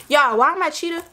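ordinary delivery

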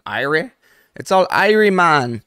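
loud, high Jamaican patois "It's all Irie"; Jamaican accent. Retold or imitated. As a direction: throw on a big Jamaican accent. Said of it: "that accent".

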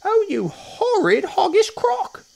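very high, slow, loud squeal; outraged monkey cry. A narrator's reading. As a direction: shriek it out as a horrified monkey. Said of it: "cried Mugglewump".

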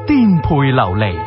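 plain reading voice

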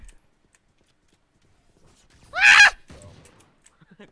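loud screaming laugh, real panic; panicked shrieking laugh. Real, not acted. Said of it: "Teriak panik". (tawa teriak panik)

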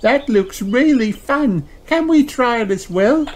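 put-on very high young kangaroo; eager child, far up in pitch; Kylie. A narrator's reading. Kylie asks Peppa to join in, high and eager. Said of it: "gasped Kylie".

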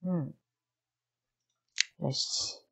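ordinary talk

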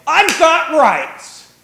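high, slow, loud indignant cry; indignant shout. Retold or imitated. Preacher mocking people who insist on their rights, shouting indignantly. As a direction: belt out the outrage of someone demanding rights.

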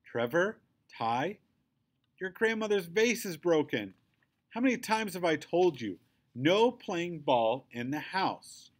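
crying out. A narrator's reading. Mom rushing upstairs to a broken vase, crying out in reproach. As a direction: call out the names, then scold, slowing at the end. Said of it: "and she cries".

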